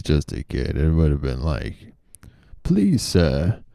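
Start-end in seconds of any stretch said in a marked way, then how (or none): 2.6-3.6 Oliver Twist plea. Retold or imitated. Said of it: "Oliver Twist vibes".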